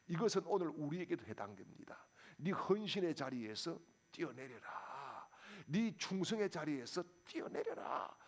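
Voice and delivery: normal talking voice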